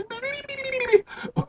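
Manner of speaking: high squeaky gnome cackle. Acted. Gnome laughing after his hint, high squeaky cackle.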